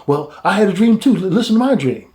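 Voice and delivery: lightly excited prisoner; eager. Retold or imitated. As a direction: jump in like he can't wait his turn. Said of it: "the other man was kinda excited".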